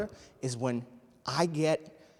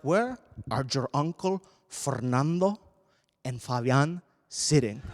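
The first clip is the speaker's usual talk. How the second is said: put-on thick Latin accent, demanding mother; stern Spanish-accented mom; 2 semitones lower than usual.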